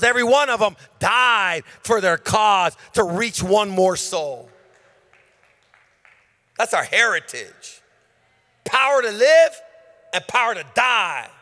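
high, loud preaching build; full-throated revival preaching; preaching at full force. Acted. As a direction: drive it home with a rising shout.

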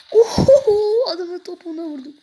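bursts out laughing, then words tumble out fast through the laugh; giggling triumph, quick and breathless. Real, not acted. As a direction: laugh at your own lucky hit. Lands a lucky shot in game.

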